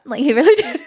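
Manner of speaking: shy giggle as you insist; embarrassed giggle; giggling. Real, not acted. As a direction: laugh through the words. Giggling at the compliments.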